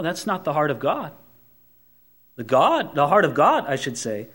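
ordinary delivery

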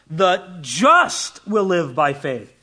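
"just" punched, slow and firm; slow delivery with the weight on "just"; stress on just. Shown as a demonstration. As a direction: hit the word "just" and let the rest fall. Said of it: "the emphasis is on just".

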